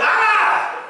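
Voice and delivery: high, loud squeal of fright; hammed-up ghost scream; mock scared scream. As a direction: shriek like a frightened sissy. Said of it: "screamed like a bunch of sissies".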